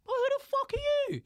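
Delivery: very high-pitched indignation; indignant retort. Acted. Comedian answering people who censor him, indignant. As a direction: shoot up high in outraged disbelief.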